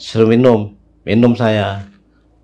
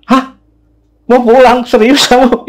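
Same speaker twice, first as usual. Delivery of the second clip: high, loud incredulous questions; shocked disbelief; 11 semitones higher, 5 dB louder than usual. Retold or imitated. Neighbourhood head hearing the guest wants home, imitated in shock. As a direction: fire the questions high and startled.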